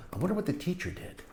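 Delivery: plain reading voice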